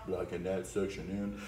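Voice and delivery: a soft, low final take; hushed repeat. Shown as a demonstration. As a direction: drop the line down to a murmur. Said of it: "One more time".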